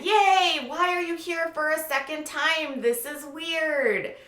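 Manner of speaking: high drawn-out mock yay sinking into puzzlement; high sarcastic welcome, stretched and slowing; put-on delight that slides into disbelief; fake cheer turning to bewilderment. Mock reaction to Hannah returning.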